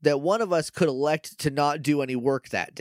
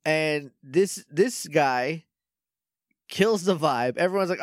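ordinary delivery

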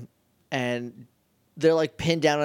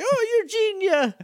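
much higher, slow put-on falsetto; mock Skull voice; slower than usual. Skull calling to his partner on the park bench in a much higher, slow falsetto.

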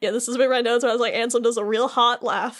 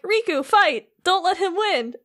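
higher, urgent call to fight; urgent rallying cry; 7 semitones higher than usual. Acted. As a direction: cheer the hero on from the light. Mickey's light urges Riku.

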